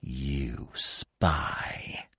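whispered hiss, full of contempt; venomous drawn-out whisper. A narrator's reading. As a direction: hiss it low and hatefully. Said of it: "he hissed".

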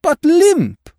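high, loud outburst of dismay; exclaimed lament. Acted. Fussy detective bemoaning his limp state, exclaimed lament.

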